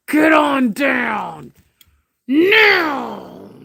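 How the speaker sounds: long, stretched-out scolding holler; yelled command at pet. Real, not acted. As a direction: order the cat down, slow and loud. Scolding the cat, yelling.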